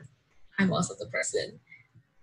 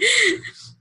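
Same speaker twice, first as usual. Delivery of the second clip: giggle; 6 dB louder than usual. Real, not acted. Laughs after joking "pick me.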